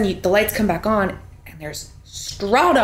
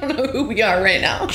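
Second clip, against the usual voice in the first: normal talking voice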